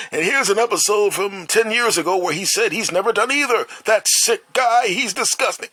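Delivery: high-pitched mock outrage; hammed-up exposé, pitched well up; mock scandal commentator. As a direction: play a disgusted accuser in a high put-on voice. Imagined future report exposing him.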